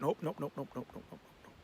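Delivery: repeated quick refusals dying away to almost nothing; fading nopes. Real, not acted. Fan correcting his schedule slip, fading away.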